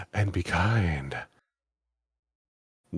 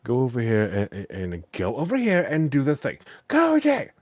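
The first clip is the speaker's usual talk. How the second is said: mock exasperated orders, jumping higher at the end; snapping; 4 semitones higher, 4 dB louder than usual. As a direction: imitate the pushy player, fed up. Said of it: "I snapped".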